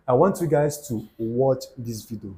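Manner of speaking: normal talking voice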